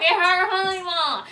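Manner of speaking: high loud cooing mom; mock mom. Mom filming her in labor, high loud cooing.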